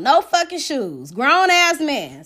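ordinary talk

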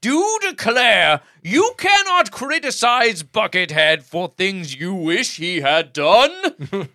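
slow, loud, grand decree voice; mock pompous proclamation. Host mocking a commenter as a pompous lawgiver, grand slow proclamation. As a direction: declare it like a founding father reading a constitution.

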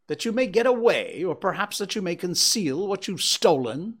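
high, loud, scornful accusation; accusing retort. A narrator's reading. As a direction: throw his request back at him. Father's scornful retort to son.

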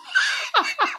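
very high squeal of laughter. Real, not acted. Laughing at Wet Farts.

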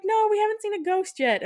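put-on whiny kids. Kids refuse mother's bedtime order, high whiny protest. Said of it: "they're like".

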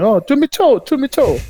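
put-on high, lightly French-accented call; playful French accent, pitched high; mock French greeting. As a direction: sing out the greeting like a local Frenchman. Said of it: "Everywhere he was going".

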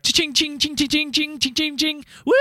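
high sing-song cha-ching imitation; slot machine sounds.

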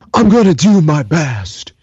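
light take on a nervous action hero; Nick Cage impression. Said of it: "So Nick Cage looks at him and he goes".

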